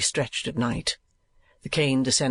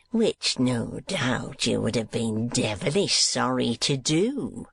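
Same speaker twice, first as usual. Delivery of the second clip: slow, mocking schoolmaster; slow sarcastic taunt; slower than usual. A narrator's reading. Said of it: "in a taunting fashion".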